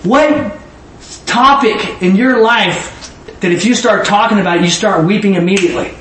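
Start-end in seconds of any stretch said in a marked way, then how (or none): none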